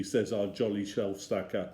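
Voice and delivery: ordinary delivery, nothing marked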